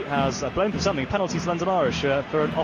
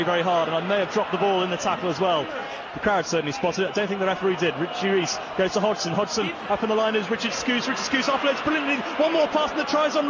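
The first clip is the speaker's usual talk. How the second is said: excited live commentary; 8 semitones higher than usual. Real, not acted. Commentator calling a London Irish try for viewers, building excitement. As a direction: call the attack rising to a shouting peak, then settle.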